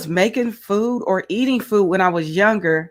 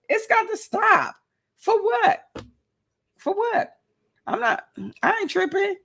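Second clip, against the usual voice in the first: much higher, animated and sassy; sassy rant; 9 semitones higher than usual. Real, not acted. Host scolds viewers about infighting, high sassy rant. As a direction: fire it off with attitude, pitched way up.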